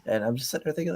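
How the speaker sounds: ordinary talk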